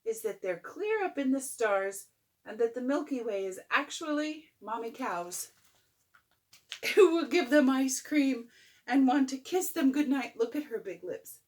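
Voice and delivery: ordinary delivery, nothing marked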